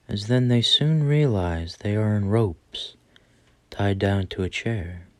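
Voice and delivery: usual speaking voice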